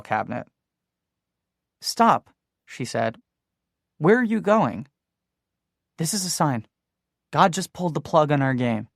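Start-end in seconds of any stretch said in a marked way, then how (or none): 1.8-2.3 sudden high, loud call; sharp call. A narrator's reading. Mary stops him in dark. 4.0-8.9 urgent. A narrator's reading. Mary urging him to stay.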